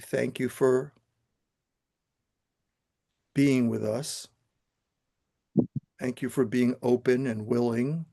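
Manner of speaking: calm, steady guiding voice, unhurried; guided meditation. Acted. Teacher guiding the class through the visualization, calm meditation voice. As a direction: lead the room gently into stillness.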